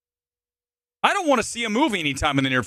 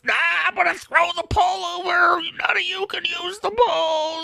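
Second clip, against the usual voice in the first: mocking the trailer. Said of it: "echoing the trailer".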